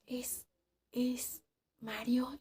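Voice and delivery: hushed, hesitant whisper; frightened girl whispering a guess at the footsteps. Retold or imitated. Guessing who is outside.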